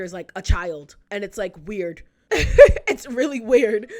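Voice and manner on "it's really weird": laughing. Real, not acted. Laughing after the remark.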